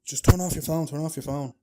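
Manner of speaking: loud, high-pitched urging; acted urgency. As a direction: press them urgently to do it now. Acted urgent warning to Chris.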